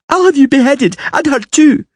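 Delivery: a spoilt little tyrant; threatening princess. A narrator's reading. Girl threatens.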